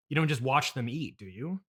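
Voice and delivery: ordinary talk, nothing marked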